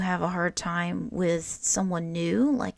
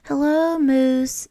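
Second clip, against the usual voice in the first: higher and louder, a bright chorus hello; class greeting in unison; 7 semitones higher, 9 dB louder, slower than usual. Acted. Class greets the new classmate Moose together, loud cheerful chorus. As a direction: greet the new kid like a whole class at once. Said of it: "said the class in unison".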